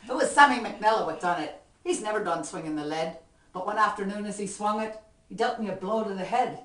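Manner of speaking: lively Irish ballad recitation, rhythmic; poem recitation. Acted. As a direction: perform the comic poem with swing.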